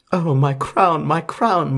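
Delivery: high, loud moan of loss; lightly acted crown lament.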